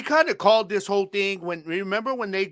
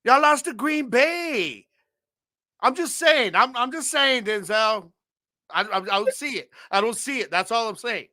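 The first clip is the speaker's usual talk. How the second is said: teasing; 6 semitones higher than usual. Real, not acted. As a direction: needle him with a grin. Teasing rival fan, high pitched.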